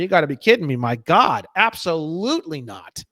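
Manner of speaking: ordinary talk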